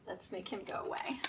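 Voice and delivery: quick hushed whisper. Real, not acted. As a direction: whisper it fast like a secret. Whispered aside to children.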